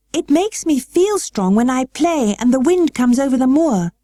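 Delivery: put-on high girlish voice, pressing her point; a girl insisting she is strong; arguing girl. A narrator's reading. Mary arguing with her guardian, high and insistent. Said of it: "argued Mary".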